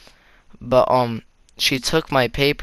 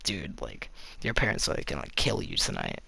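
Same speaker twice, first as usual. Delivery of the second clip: low and much softer, a secret warning; hushed warning; 6 semitones lower, 12 dB quieter, faster than usual. Retold or imitated. Student quietly warning a friend about angry parents, hushed. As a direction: lean in and warn him quietly.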